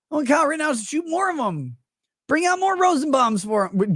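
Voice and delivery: high, hammed-up indignant fan voice; two imagined fans bickering, hammed-up high voice; mock outraged fan. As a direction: play a fan insisting, over the top.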